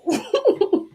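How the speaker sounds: high-pitched rising laugh. Real, not acted. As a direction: crack up mid-sentence. Laughs at peanut head remark.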